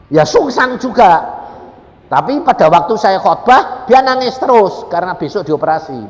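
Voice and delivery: loud, high, emphatic sermon delivery; full-force preaching. Real, not acted. Preacher telling the congregation a second case, full-force preaching. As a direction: drive each phrase out to the hall.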